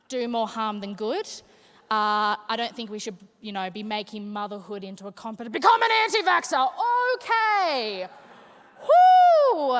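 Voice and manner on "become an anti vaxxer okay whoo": high, loud and fast, building to a whoop; manic shouted urging. Pretend. Uterus egging her on, then whooping. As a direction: yell it like an unhinged cheerleader.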